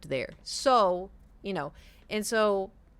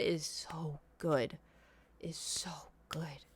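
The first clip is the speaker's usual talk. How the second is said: whispered, slow relish; hushed reverence; 3 semitones lower, 9 dB quieter, slower than usual. Real, not acted. Confiding how good the ketchup is, whispered. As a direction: drop to an awed whisper.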